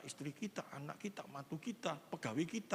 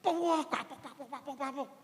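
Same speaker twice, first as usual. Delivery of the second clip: mock beating cry; 7 semitones higher, 4 dB louder than usual. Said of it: "Kalau dari tangan".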